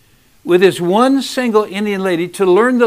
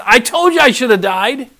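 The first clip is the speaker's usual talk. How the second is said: angry Jonah; 5 semitones higher, 7 dB louder than usual. Retold or imitated. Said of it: "Jonah's mad again".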